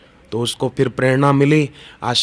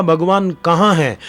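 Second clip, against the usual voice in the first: raised, louder challenge of a demon king; Hiranyakashipu; 5 semitones higher, 3 dB louder than usual. Retold or imitated. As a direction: throw out the question high, as a challenge. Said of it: "Hiranyakashipu बोलता था".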